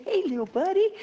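put-on high sing-song to a toddler; playful baby voice; baby talk. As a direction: coo at the little boy. Talking to the baby.

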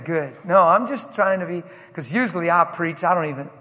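loud, fast building sermon; fiery preaching. Acted. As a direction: preach it with rising fire. Preaching at full force.

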